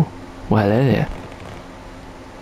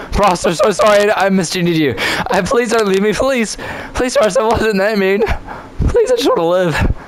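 high, whiny begging for mercy; hammed-up apologetic plea; mock pleading; 5 semitones higher than usual. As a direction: beg forgiveness in playful desperation. Mock begging a teammate for forgiveness.

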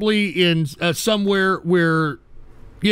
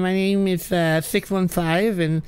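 lightly voiced impression of the caller; mocking caller's voice. Host mimicking a caller to ridicule his voice. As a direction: play the dopey caller, a hint of mockery. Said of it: "you got my heart beating like a rabbit with that voice".

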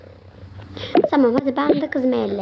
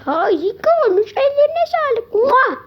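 gushing praise; 13 semitones higher, 4 dB louder than usual. Acted.

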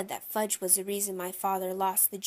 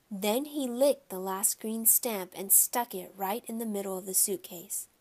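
ordinary delivery, nothing marked

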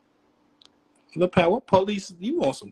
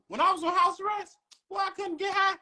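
high-pitched exclaim; 13 semitones higher than usual. Real, not acted. Telling friends about house arrest, voice climbing high. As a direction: voice jumps way up, exclaiming.

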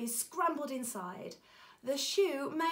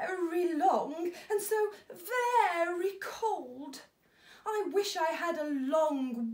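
high, sniffly, tearful giraffe; sad giraffe; 6 semitones higher, 4 dB louder than usual. A narrator's reading. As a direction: whimper it like a cold giraffe. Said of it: "sniffing sadly".

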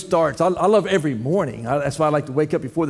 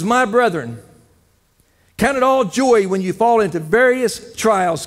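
scripture reading; 3 semitones higher than usual. Real, not acted. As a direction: read the scripture aloud, proclaiming it. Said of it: "It says".

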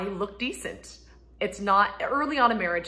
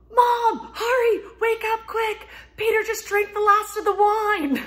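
much higher, louder, slow urgent call; imitating kid's yell; 9 dB louder, slower than usual. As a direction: play the child shouting the alarm. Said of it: "ran in yelling".

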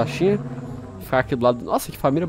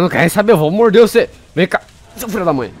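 louder, higher fighting taunt; aggressive taunt; 4 semitones higher, 6 dB louder than usual. Real, not acted. Player taunting a dinosaur as he attacks. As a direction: go in for the bite.

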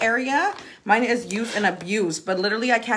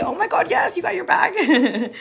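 high, laughing delight; excited squeal; 9 semitones higher than usual. Real, not acted. Excited reading of customer message.

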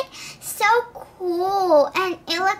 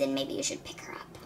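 whisper; 2 semitones lower, 12 dB quieter, faster than usual. Acted. Nurse on phone to mother.

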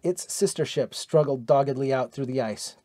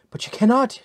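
cried out; 9 semitones higher than usual. A narrator's reading. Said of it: "she cried".

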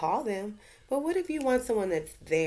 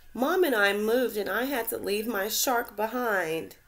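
a hint of a tearful young fish; sad little remora; 6 semitones higher, 5 dB louder than usual. The remora tells Gilbert why she cries, lightly tearful. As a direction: voice her as a small sad fish. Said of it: "She sobbed".

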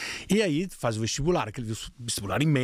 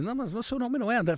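higher, fast daughter's protest; imitating daughter; 10 semitones higher, faster than usual. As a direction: play the daughter correcting her father, quickly. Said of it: "Minha filha sempre fala assim".

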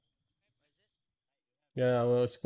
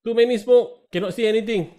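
shouting; 9 semitones higher, 5 dB louder, faster than usual. Real, not acted. Firefight callouts.

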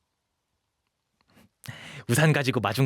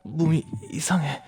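higher, faltering gasps between phrases; distressed, overheated; 5 semitones higher than usual. Acted. Runaway feeling body and heart change, gasping in distress. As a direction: struggle to get the words out, short of breath.

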